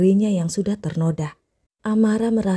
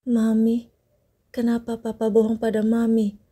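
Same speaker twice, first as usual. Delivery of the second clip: put-on a faltering, halting child's question; 2 semitones higher than usual. A narrator's reading. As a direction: stumble gently over the words. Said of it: "tanya Amara terbata-bata". (bertanya dengan terbata-bata)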